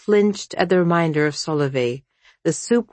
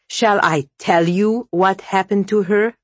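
higher, louder challenge with rising anger; indignant, pointed question; growing anger; 4 semitones higher, 5 dB louder than usual. A narrator's reading. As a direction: let anger start to build in the question. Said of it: "Vasya asked, growing angry".

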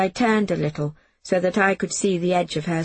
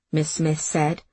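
ordinary delivery

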